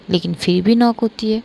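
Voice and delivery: ordinary delivery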